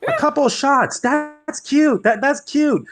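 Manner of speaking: high-pitched mock delight. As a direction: scoff at it in a very high voice. Scornful reaction to the party's plan.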